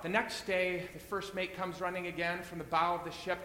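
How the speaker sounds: usual speaking voice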